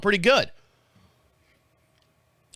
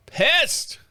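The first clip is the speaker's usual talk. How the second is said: Pissed! jumped much higher, exclaimed; emphatic repeat; 10 semitones higher than usual. Real, not acted. Repeating how mad he was, with a high exclaim. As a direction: fling out Pissed! high and indignant. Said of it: "I was so pissed".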